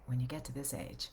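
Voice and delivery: soft, low, intimate read; hushed financial voiceover, near the listener; close-up delivery. Shown as a demonstration. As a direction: speak to someone right close up to you. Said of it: "like, right close up to me".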